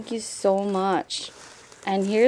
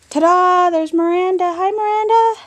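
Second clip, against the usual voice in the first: high, loud, delighted greeting; real squealing-high excitement; excited reveal; 9 semitones higher, 9 dB louder than usual. Real, not acted. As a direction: sing out a big excited reveal. Excited reveal of friend's album page.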